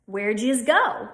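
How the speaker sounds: Delco "yiz" lines said in casual speech; short "yiz" in Delco phrases; Delco accent. Shown as a demonstration. Teacher gives Delco example sentences in a Delco accent. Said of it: "So it's that Y sound".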